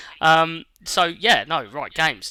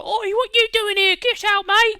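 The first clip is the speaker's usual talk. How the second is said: higher, louder mock shout of a cockney thug; rowdy enemy chav; 6 dB louder than usual. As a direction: bark at the intruder like a mouthy game enemy. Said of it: "the way the characters, like, the enemies and stuff, shout at you".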